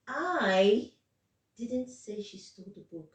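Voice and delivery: I stressed, rest falls away. Shown as a demonstration. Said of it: "Now the stress is on".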